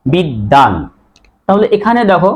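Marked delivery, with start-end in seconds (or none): 0.1-0.7 বিদ্যান said bid-dan, lower, no b; doubled d. Shown as a demonstration. Teacher answers own question, d doubled. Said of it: "এটা উচ্চারণ কি হলো?".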